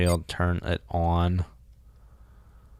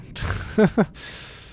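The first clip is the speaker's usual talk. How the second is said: wheezy laugh. Real, not acted. Mission incomplete irony.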